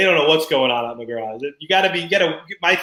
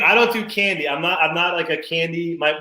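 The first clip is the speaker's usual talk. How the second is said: ordinary delivery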